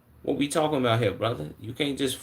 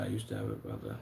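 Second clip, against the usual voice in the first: imitating a low voice; 13 semitones lower, 11 dB quieter, faster than usual. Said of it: "He said".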